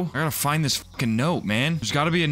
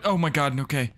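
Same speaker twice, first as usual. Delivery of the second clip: ordinary talk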